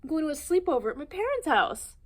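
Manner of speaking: high, loud, bursting with joy; excited exclaim. Acted. Young ape shouting about his sleepover, high and loud. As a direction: shout the good news. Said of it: "Jim exclaimed".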